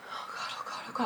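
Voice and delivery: whispered oh God in fear; panicked whisper. Retold or imitated. Woken at night, nauseous, whispering in panic. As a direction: whisper it, barely holding panic. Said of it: "I'm like".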